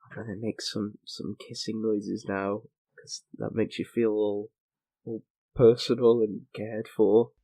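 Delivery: mock ASMR murmur. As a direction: hush it like an ASMR video, teasing. Parodying ASMR whisperer.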